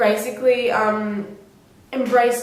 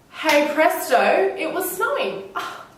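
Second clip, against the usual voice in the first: excited; 7 semitones higher, slower than usual. Real, not acted. Thrilled about snowing.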